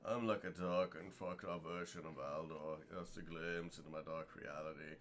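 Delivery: low, soft, brooding emo voice; whiny emo teen. Pretend. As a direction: read it like a gloomy emo kid. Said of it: "I can read this comment with an emo voice".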